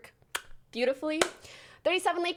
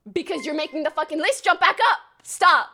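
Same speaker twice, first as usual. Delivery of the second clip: loud, building to a shout; scold, then yell the last word; exasperated scolding; 2 semitones higher, 8 dB louder than usual. Real, not acted. Scolding a subscriber.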